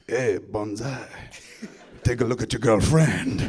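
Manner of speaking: mock Italian movie-villain accent. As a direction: imitate the film line in a thick Italian accent. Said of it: "That's how I learned Italian".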